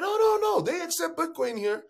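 lively retelling of showing a skeptic the app; eager demonstrator. Retold or imitated. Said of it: "I'm like".

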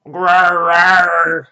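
loud monster grrr; yelled growl. Acted. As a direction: growl it out at the teacher. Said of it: "Anthony yelled".

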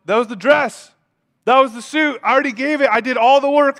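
high, emphatic mock exasperation; acted exasperation. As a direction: protest in comic disbelief. God exasperated at ignored gift.

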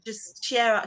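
ordinary talk

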